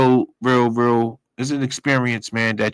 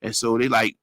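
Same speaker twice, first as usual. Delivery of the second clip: ordinary talk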